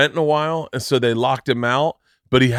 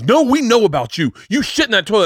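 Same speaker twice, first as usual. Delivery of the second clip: higher-pitched, insistent mock accusation; super aggressive; 8 semitones higher, 3 dB louder than usual. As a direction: imitate someone hammering an accusation home. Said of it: "this is super aggressive".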